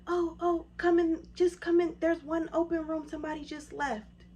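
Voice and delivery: high, loud hurried ushering; flustered receptionist. Retold or imitated. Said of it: "She's like".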